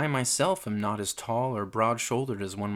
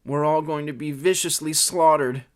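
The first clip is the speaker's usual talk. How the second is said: higher, louder wail of doom; groaning; 5 semitones higher, 5 dB louder than usual. Acted. Petey predicting slaughter to cagemates, groaning loudly. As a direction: groan it out with rising dread. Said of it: "groaned Petey".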